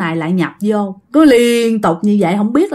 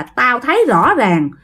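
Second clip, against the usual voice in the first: higher-pitched, emphatic retold testimony; pleading insistence in a raised pitch; insisting; 6 semitones higher than usual. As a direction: insist on what happened to you, voice pitched up. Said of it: "bà Út khẳng định rằng á là".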